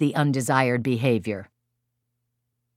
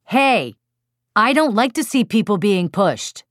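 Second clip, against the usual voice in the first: raised, forceful objection; strong feeling; 6 semitones higher, 4 dB louder than usual. Shown as a demonstration. Parent to child who pushed his sister, strong loud objection. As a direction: call out your disapproval strongly.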